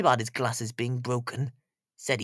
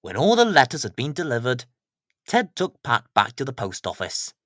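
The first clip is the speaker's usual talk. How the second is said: ordinary delivery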